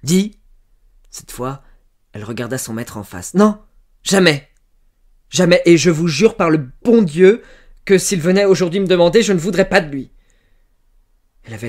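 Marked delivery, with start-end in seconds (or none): 0.0-0.4 loud, high, pressing interrogation; interrupting. A narrator's reading. Farmer cross-examining servant girl about Jacques, interrupting. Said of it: "Il l'interrompit". 3.3-10.1 loud, high, impassioned denial; vehement oath. A narrator's reading. Servant girl swearing before God to her master, vehemently.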